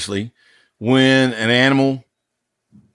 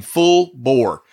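ordinary delivery

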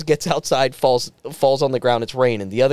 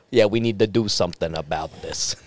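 low, softer mob-guy mumble; cigar-puffing mobster; 8 semitones lower, 4 dB quieter than usual. Acted. Other ghost puffing on a cigar, low mobster voice. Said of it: "puffing on a cigar".

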